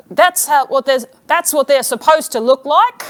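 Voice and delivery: loud, put-on Australian husband; mock Australian accent. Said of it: "he can't do his own accent".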